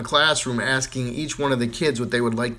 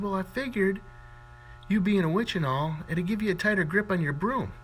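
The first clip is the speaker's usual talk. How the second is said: put-on lightly raised, innocent little boy; cheeky kid voice; 9 semitones higher than usual. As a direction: play it sweet and innocent. Johnny's cheeky answer voiced.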